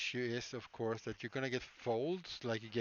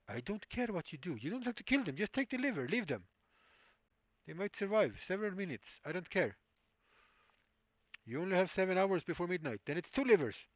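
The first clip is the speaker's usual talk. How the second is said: put-on higher, cold Russian accent villain; 7 semitones higher, 4 dB louder than usual. Surgeon sends patient off for livers, higher Russian accent. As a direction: dismiss him like a Hollywood Russian bad guy. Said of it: "Still don't know why I do Russian".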